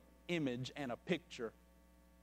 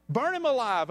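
louder, higher mob shout; 9 semitones higher, 12 dB louder than usual. Acted. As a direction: call for his death over the crowd. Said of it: "other voices shouted".

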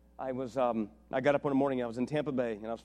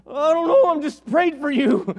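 excited reply; 11 semitones higher, 12 dB louder than usual. Retold or imitated. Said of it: "And I'm like".

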